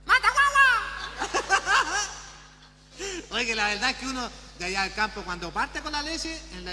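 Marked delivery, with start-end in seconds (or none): 0.0-0.7 very high, very loud jeer; taunting shout. Retold or imitated. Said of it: "le dicen". 0.7-2.7 high, loud laugh; high laughter. Real, not acted. Laughs at own story.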